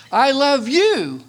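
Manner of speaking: "you" drawn out high and loud, then falling; stress on YOU. Shown as a demonstration. Preacher recalls speech-class lesson, stressing "you". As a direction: save the big push for "you". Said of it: "emphasize each word differently".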